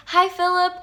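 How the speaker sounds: high-pitched retold fan greeting; excited greeting. Greeting her idol, retold.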